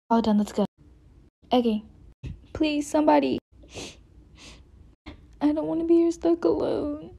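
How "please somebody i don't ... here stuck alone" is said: high, lonely pleading; high plea for help. Acted. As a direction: call out hoping someone hears. Tied girl calling for help.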